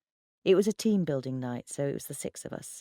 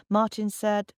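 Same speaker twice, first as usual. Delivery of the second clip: plain reading voice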